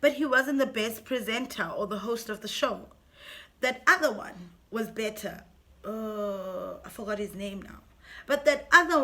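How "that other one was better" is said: ordinary delivery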